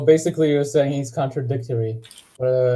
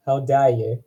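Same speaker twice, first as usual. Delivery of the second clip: attempted New Zealand accent on an indignant line. Shown as a demonstration. Showing how to say it, New Zealand accent. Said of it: "say it in a New Zealand accent".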